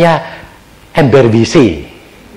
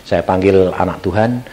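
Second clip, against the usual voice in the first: ordinary talk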